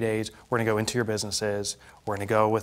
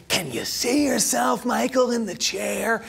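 a hint of a slow, higher coach painting a picture; vivid description; 8 semitones higher than usual. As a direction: slowly paint the scene for him. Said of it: "visual description".

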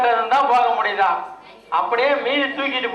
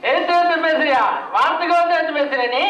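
higher, fast angry snap at the wife; angry retort; 5 semitones higher than usual. Acted. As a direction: bite back at her, offended and fast. Drunk husband quarrels with wife.

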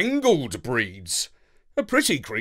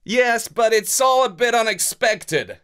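lightly voiced pilot calling out, a bit higher; a hint of a busy pilot calling over the noise; Marlowe calling out; 6 semitones higher than usual. A narrator's reading. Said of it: "called out Marlowe".

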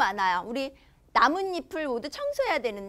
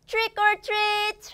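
put-on high, playful kid's door call; trick-or-treat call; 8 semitones higher, much slower than usual. Teacher voicing a child at a neighbour's door, playful call.

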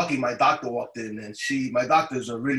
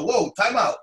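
high, loud startled halt; loud, raised-pitch stop; retold interruption; 6 semitones higher, 5 dB louder than usual. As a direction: cut in abruptly, as the doctor. Doctor interrupts him in a story.

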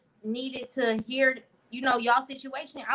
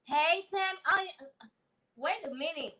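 ordinary delivery

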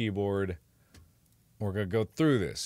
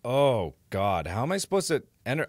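normal talking voice

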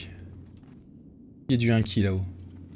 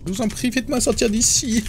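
mock pleading prisoner; 13 semitones higher than usual. Voicing trapped prisoner pleading.

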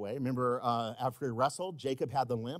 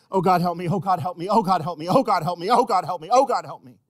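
urgent, repeated plea, raised volume; retold desperate prayer; 5 semitones higher, 10 dB louder than usual. Preacher retelling a mother's prayer in the car, urgent repeated plea. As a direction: imitate someone praying over and over, loudly. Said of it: "like Dovey in that car praying".

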